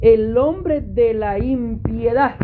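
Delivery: "el hombre de la impiedad" drawn out very slowly; emphatic scripture reading. Real, not acted. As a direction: give each word of "el hombre de la impiedad" room and weight. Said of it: "Aquí es donde quiero hacer énfasis".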